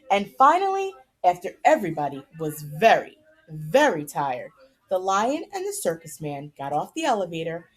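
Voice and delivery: lively storybook narration; reading to children. A narrator's reading. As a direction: read the chapter aloud to the kids with animated character lines. Reads chapter aloud to kids.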